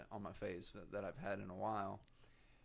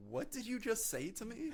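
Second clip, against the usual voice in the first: angry; 3 dB louder than usual. Retold or imitated. Retold confrontation with dad.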